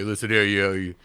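loud, puffed-up tough guy; Jeff. Retold or imitated. Guitarist putting someone in their place, loud blustering impression. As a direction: talk down to them like a blustering big shot. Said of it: "but he just has that talk".

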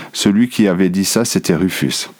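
usual speaking voice

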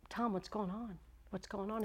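soft, concerned question; retold pastor's hushed check-in; gentle concern. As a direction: ask it softly of someone upset. Pastor asks a weeping elder.

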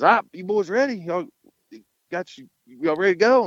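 imitated boss, pitched well up; high-voiced call to get riders going; rallying the boys. Boss to nervous bull riders at loading time, rallying.

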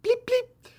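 high, loud bleep bleep; imitated car alarm bleep. Copying the car alarm as the owner comes. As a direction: squeak out the alarm chirp.

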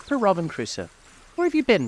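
high-pitched, exclaimed call; excited calling. A narrator's reading. Scrooge recalling the parrot's greeting to Crusoe, excited calling. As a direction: call out high, falling away at the end.

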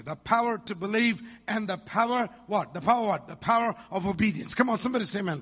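full force preaching. Acted. Pastor preaching scripture to his church, loud and ringing. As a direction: preach it out to the congregation.

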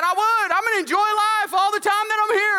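mock-defiant answer to the clerk; defiant retort. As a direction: throw it back at her boldly.

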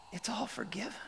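high, voiceless and soft; whispered reassurance; hushed whisper. Acted. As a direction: let it out on a breath. Forgiveness declared in hush.

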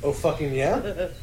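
ordinary delivery

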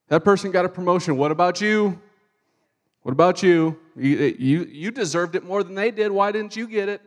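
lightly acted devil, loud and needling; nagging devil. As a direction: pester loudly as a mock tempter. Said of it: "nagging voice in the background".

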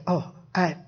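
low, hushed second thoughts; hesitant self-talk. Acted. Preacher voicing a tempted listener's second thoughts, low and hesitant. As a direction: talk yourself out of it, softly and low. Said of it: "or you might say".

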